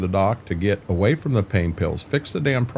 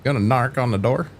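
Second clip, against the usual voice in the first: ordinary talk, nothing marked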